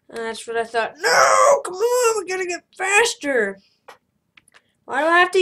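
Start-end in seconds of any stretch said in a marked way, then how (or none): 1.0-3.6 frustrated shout. Real, not acted. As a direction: yell at the game. Game failure.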